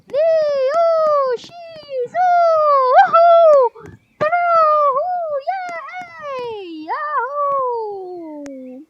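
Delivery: high, loud yahoos from the ride; excited cheering. Acted. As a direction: whoop and cheer while riding. Riders cheering on moving ride.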